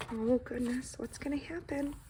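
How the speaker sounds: breathy worried whisper; suspense whisper. Acted. Reader whispers to children, worried whisper. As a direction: whisper it like a secret worry.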